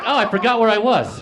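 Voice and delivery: high, loud blurt; sudden dismayed realization. Retold or imitated. Comic at show for stuttering children remembers, dismayed realization. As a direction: blurt it out as it dawns on you.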